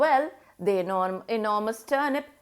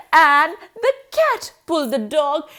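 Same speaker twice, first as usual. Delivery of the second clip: usual speaking voice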